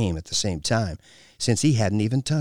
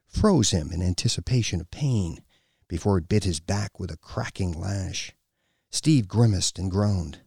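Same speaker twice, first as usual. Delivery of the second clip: plain reading voice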